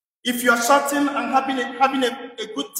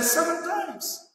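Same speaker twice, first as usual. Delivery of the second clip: slow, higher and softer; slow Peter quote; 6 semitones higher, 5 dB quieter, much slower than usual. Retold or imitated. Peter offers Jesus his count, slow and softer. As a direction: lay out the number slowly like a proud offer. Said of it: "Peter went to Jesus and said".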